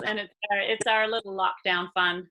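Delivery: ordinary talk, nothing marked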